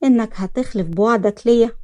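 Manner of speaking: ordinary delivery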